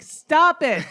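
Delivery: mock exasperated cry, high and loud; retold protest. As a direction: protest loudly through laughter, imitating a friend. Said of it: "you were like".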